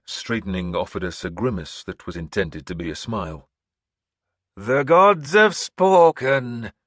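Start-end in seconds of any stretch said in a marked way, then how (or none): 4.6-6.7 slow, high, loud proclamation; old seeress announcing. Acted. Seeress announcing to the hall, high and slow. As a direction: proclaim like an eerie prophetess. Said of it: "she announced".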